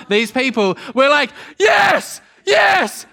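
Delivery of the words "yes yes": high, loud chanted cheers; crowd cheering. Retold or imitated. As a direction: cheer like the whole line of cars. Traffic cheering the rescue.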